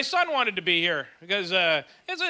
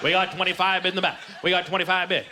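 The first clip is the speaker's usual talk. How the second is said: rambling auctioneer; 6 semitones lower than usual. Pretend. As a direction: lower auctioneer chant drifting off topic. ADD auctioneer routine.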